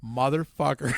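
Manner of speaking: low muttered curse; imitating a dawning resentment; voiced grudge. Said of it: "you're like".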